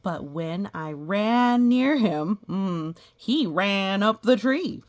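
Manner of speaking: loud, slow storytelling; loud slow read-aloud. A narrator's reading. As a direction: boom out the chase. Story climax read louder.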